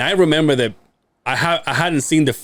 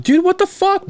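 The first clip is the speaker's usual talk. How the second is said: much higher joking indignation; mock indignant. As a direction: protest jokingly. Mock complaint about wife's post.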